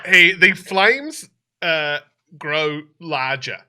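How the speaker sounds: ordinary talk, nothing marked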